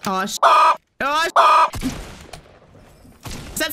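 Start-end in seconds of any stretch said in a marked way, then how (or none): 0.4-1.8 high, loud startled cry; startled yelp. Real, not acted. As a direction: yelp as you get hit. Startled in fight.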